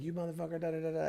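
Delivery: high, hushed mock yell; retold angry yell. Offended lady to comic as she storms off: softened mock yelling. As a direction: imitate an angry rant, held well down in volume. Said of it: "she's pissed off. She's yelling".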